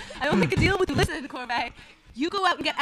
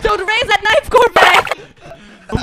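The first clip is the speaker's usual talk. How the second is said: alarmed shout; 6 semitones higher, 6 dB louder, much slower than usual. Acted. Threatened with a knife.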